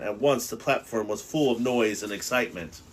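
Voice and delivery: ordinary delivery, nothing marked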